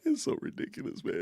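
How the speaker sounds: high, breathy laugh through the words, far above his normal voice; giggling at how absurd it is; squeaky laughing disbelief. Real, not acted. Incredulous laugh at a hoodie post.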